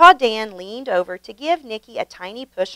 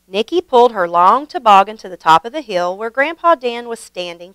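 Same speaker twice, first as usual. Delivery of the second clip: ordinary delivery, nothing marked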